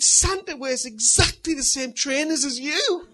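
put-on high, loud, drawn-out child voice; imitated excited daughter. Daughter telling dad her discovery, high loud mimicry. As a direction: proclaim it slowly as an amazed child. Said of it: "She said".

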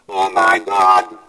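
loud, drawn-out mock cry to God; grito imitado. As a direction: cry out loudly, imitating a desperate man. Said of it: "he cries out".

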